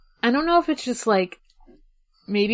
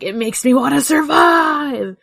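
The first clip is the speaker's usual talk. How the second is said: dramatic spooky; 5 semitones higher, 8 dB louder than usual. Pretend. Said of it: "ooh, spooky".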